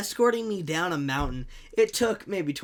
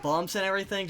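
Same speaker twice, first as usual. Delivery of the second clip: ordinary talk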